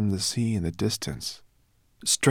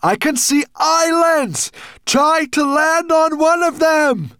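slow, high, full-voice shout; urgent stretched-out shouting; yelled; 8 dB louder, slower than usual. A narrator's reading. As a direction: yell each word out over the waves. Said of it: "yelled Ben".

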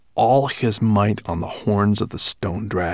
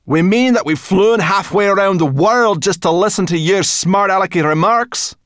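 high, loud angry retort; snapping brownie; 12 semitones higher, 9 dB louder than usual. A narrator's reading. Sorrel snaps at the strange brownie, high and loud. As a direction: snap back at him.

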